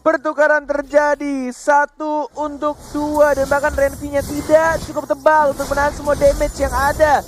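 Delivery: fast, loud play-by-play hype; esports caster. Real, not acted. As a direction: call the fight like a caster. Live play-by-play of fight.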